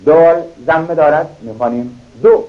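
ordinary talk

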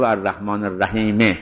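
الرحیمه stopped on a short final e; pause on the vowel. Shown as a demonstration. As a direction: let the e of الرحیمه sound before pausing. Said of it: "اما وقف کرد به حرکت".

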